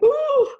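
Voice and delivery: loud, very high "ooh"; movie-style "ooh". Retold or imitated. Imitating a movie moment on hearing Spike Lee, high amazed "ooh". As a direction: let out a high, hammed-up movie swoon.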